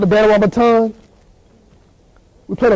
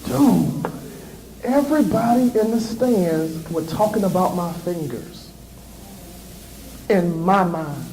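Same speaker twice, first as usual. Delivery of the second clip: quiet inner voice; 4 semitones lower, 7 dB quieter than usual. Retold or imitated. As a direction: lower and softer, to himself. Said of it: "I was like".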